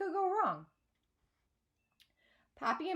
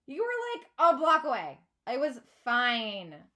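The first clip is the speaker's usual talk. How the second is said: put-on whiny teen; 3 semitones higher, 8 dB louder than usual. A narrator's reading. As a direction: keep whining, then drag it out. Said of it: "I whine".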